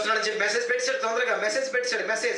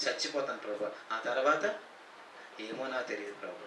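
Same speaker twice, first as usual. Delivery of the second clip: much softer, a hint of a frightened man; fearful mumble; 10 semitones lower, 10 dB quieter than usual. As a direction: mumble it low, unsure of the answer. Fearful man answers God.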